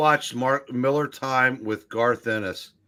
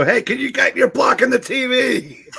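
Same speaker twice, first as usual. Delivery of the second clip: mock shouted complaint; 12 semitones higher, 6 dB louder than usual. Friend mocking himself yelling at a buddy blocking the TV, loud mock yell. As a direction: bark it at the friend in front of the screen.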